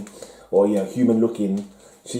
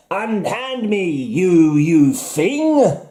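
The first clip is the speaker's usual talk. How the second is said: high, hammed-up outrage; mock-theatrical protest, pitched high; indignant captive; 8 semitones higher, much slower than usual. Captive to the monster grabbing them, high hammy indignation. Acts out a comic cover line.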